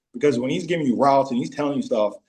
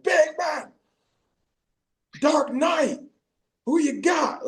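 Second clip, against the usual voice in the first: high, drawn-out mock holler; calling out challenge; slower than usual. Player challenging teammates in the movie game, high mock holler. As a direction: holler it across the field as a challenge.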